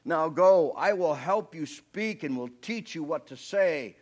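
slow majestic divine rebuke; booming voice of God. Acted. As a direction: thunder it down as the Almighty. Preacher voices God's rebuke.

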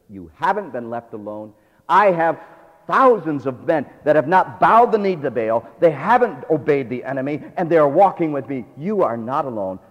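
loud, firm reassurance; booming encouragement. Acted. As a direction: lift him up with a strong voice. God encouraging Elijah.

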